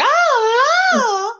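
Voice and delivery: high hammed-up moaning "Oh, oh"; high, loud mock moan of pleasure. Imitating her own moans.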